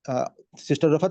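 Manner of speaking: ordinary talk, nothing marked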